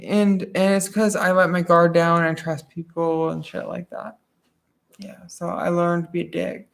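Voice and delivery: ordinary talk, nothing marked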